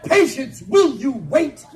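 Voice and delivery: loud, slow scolding yell; mock parent shouting; yelling at child. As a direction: stretch out the impatient call. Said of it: "yelling things like".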